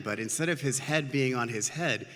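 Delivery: plain reading voice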